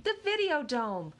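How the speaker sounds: high, eager call-outs; voiced eager children; excited kids calling out. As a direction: shout the answers from the seats.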